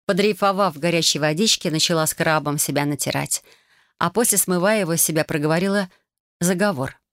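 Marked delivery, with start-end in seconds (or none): none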